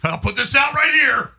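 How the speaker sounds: loud, high, drawn-out put-on holler; hammed-up character shout. Smoker by the oil spill, hammed-up holler. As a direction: play it big, slow and loud.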